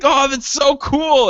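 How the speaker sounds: mock delight. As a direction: gush over the junk car. His own excited self quoted.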